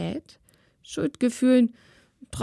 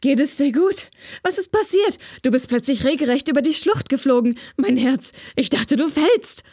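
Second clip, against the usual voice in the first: louder and fast, worried brother; agitated; 5 semitones higher, 4 dB louder than usual. A narrator's reading. Juro grabbing his sister after the fall, agitated, louder and fast. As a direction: rush it out, shaken with worry. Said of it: "fragte er aufgewühlt".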